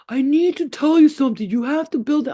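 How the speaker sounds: high, loud, insistent alien voice; ten-foot alien. Retold or imitated. Alien demanding the elf build something, loud insistent voice. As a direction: plead urgently as a giant alien. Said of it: "talked to the ten-foot alien".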